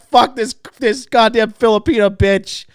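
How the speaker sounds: loud, high mock rage; hammed-up furious outburst; angry cursing. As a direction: curse an absent lover at full volume. Retold cursing of the woman blamed.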